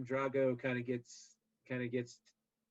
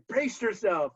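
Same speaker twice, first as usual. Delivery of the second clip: louder retold corner shout; mock yell; 7 semitones higher, 5 dB louder, slower than usual. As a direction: bark it like a cornerman. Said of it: "he yells".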